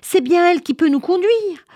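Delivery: high, excited proclamation; ostrich crying out. Acted. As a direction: announce it high-pitched to the whole crowd. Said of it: "cria l'autruche". (exclamation aiguë de l'autruche)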